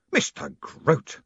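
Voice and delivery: drawn-out, stern name; snapped reprimand. A narrator's reading. Moist reining in Grote before the crowd, snapping. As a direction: pull him up sharply by name. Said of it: "snapped Moist".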